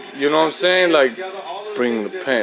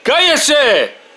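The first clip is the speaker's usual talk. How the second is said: higher and louder, furious yelling in Spanish; angry shout; 9 semitones higher, 4 dB louder than usual. Real, not acted. Owner shouting at the squawking bird, furious and loud. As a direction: blast the bird to shut it up.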